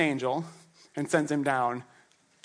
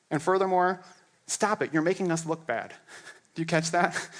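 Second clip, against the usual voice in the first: normal talking voice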